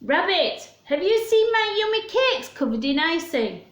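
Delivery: high, anxious bear calling to his friend; worried bear. Acted. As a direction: ask in a high, fretting bear voice. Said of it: "Bear raced outside".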